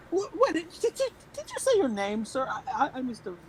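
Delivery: high, bright-toned timid politeness, well up in the voice; polite shopkeeper voice. Acted. Shopkeeper asks the Goliath his name, high and politely flustered. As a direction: ask his name with nervous courtesy.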